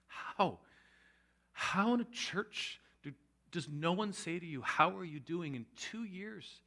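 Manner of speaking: slow, drawn-out incredulous question; appalled disbelief. Retold or imitated. Speaker voices appalled disbelief.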